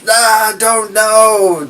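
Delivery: high, slow groaning answer; helpless clone. Pretend. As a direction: drag it out, lost and frustrated.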